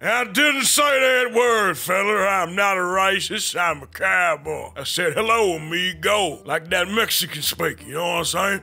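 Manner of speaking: put-on Western cowboy accent, gruff and teasing. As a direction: play the old-West cowboy mocking a name. Holds a cowboy voice all along.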